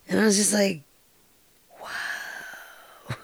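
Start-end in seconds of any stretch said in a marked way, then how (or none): 1.8-3.2 very soft, breathy-voiceless wow; stunned whisper. Retold or imitated. Recalling the moment he died, stunned whisper.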